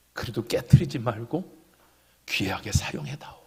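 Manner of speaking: hushed whisper. Acted. Tender plea voiced as God.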